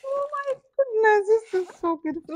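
high, gushing awe; real high-pitched delight; delighted gasp. Real, not acted. Gasping at new air fryer.

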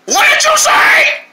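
high, loud, slow indignant shout; offended lady shout. Acted. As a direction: demand he repeat the insult. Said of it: "she is loud".

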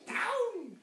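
high loud witch shout; high shouted command; witch cry. Acted. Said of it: "cried the witch".

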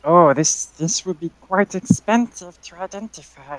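put-on old lady shopkeeper. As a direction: play an elderly woman minding her shop. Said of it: "burp in the old lady voice".

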